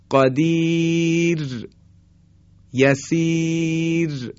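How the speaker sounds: high, very long ī in قدیر and یسیر, thin R; light R after long vowel. Shown as a demonstration. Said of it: "یاء صدای کشیدهٔ یا". (قدیر و یسیر با یای کشیده و «ر» نازک)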